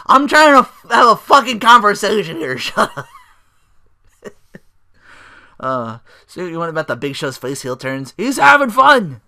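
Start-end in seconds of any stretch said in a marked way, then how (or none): 0.1-3.1 much higher, louder mock yell, fed up; Big Show yelling. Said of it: "Big Show's like". 8.2-9.2 excited. Real, not acted. Gleeful remark on Big Show.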